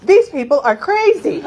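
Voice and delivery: high, loud alarmed inner voice; panicked thought. Retold or imitated. Jumper at the open door thinking in panic. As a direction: realize it in alarm. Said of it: "and thought".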